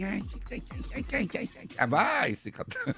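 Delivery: high, soft mock whimper; lightly voiced whining; fussy mock whine. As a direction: whine like a spoiled grown-up. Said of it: "não tem assim".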